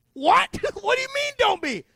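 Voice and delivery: high, loud mock outrage; hammed-up, high-pitched disbelief; indignant outburst. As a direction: squawk back at an infuriating sibling. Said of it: "I'm so mad".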